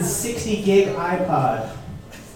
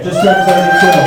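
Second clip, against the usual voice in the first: louder repeat; 7 dB louder than usual. Real, not acted. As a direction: shout the age again.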